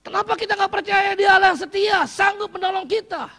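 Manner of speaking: high, loud preaching challenge; preaching at full force. Acted. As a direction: press the question on the crowd. (pertanyaan khotbah yang keras)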